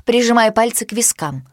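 ordinary delivery, nothing marked

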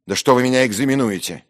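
quick, loud, high burst of irritation; indignant, hurried challenge; irritated retort. Acted. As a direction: snap back at the interrogation. Narrator snaps at being questioned.

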